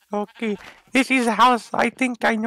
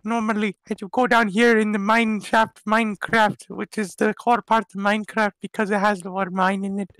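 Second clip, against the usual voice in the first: ordinary delivery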